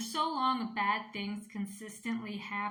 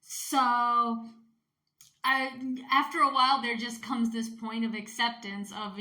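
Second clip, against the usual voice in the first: usual speaking voice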